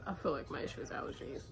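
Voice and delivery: fast whispered repeat. Real, not acted. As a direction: say it again under your breath. Repeats line under breath.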